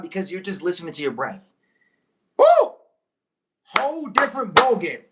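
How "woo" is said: excited whoop. Real, not acted. Reacting to own point.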